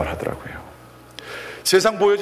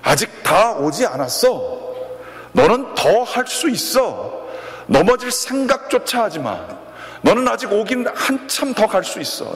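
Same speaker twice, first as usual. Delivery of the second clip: rising to a high, pushing call; coach's urging shout; 8 semitones higher than usual. Retold or imitated. Coach to blindfolded crawling student, high urging shout. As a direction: urge him on like a coach shouting. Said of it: "소리를 칩니다".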